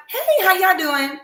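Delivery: high, sing-song hello to strangers; bright friendly greeting. Retold or imitated. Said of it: "You like".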